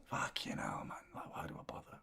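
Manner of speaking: whispered despair under the breath. Real, not acted. Fan cursing himself for tuning in, whispered. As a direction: breathe it out in defeat. Despair after the late goal.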